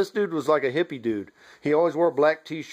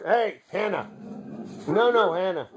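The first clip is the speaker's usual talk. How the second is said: calling the dog; 5 semitones higher than usual. Real, not acted. Calling off the dog mid-video.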